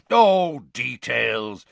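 loud, high dismissive burst; waving it away. A narrator's reading. Bagman interrupting Crouch's caution, dismissive. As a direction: scoff and brush it aside. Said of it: "waving the word away".

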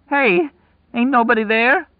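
high, desperate cry; despairing, cracked holler; voice breaking. A narrator's reading. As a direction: call out loudly, close to breaking. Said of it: "his voice breaking".

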